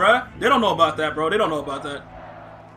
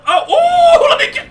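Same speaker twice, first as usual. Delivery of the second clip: laughing shout; 9 dB louder than usual. Real, not acted. Laughing outburst during play.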